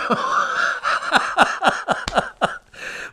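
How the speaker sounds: long high wheezing laugh; high breathy laughing fit; big laugh. Real, not acted. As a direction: laugh hard at your own story. Teller laughs hard.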